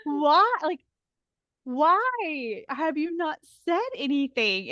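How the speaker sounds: high, loud, then slowing; indignant why. Retold or imitated. Speaker pressing the pastors, loud and high. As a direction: press them again and again. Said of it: "And I was like".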